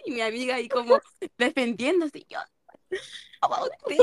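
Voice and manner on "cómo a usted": surprised. Retold or imitated. Teacher's outraged retort retold.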